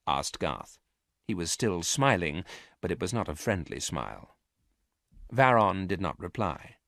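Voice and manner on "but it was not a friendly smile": plain reading voice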